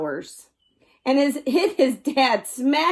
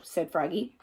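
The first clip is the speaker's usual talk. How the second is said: usual speaking voice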